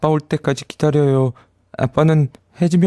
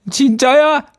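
much higher, squeaky disbelief; astonished; 14 semitones higher than usual. Acted. 모래 요정이 믿지 못해 높게 외치며. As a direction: leap up in shock. Samiad can't believe the promise.

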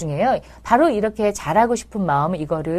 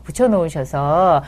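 ordinary talk

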